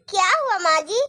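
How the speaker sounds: ordinary talk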